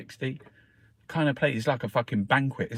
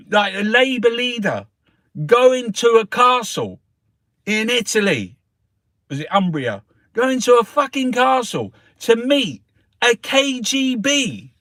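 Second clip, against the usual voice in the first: much higher, louder indignant ranting; outraged rant; 9 semitones higher, 6 dB louder than usual. Real, not acted. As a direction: tear into Boris, incredulous. Outraged rant about Boris.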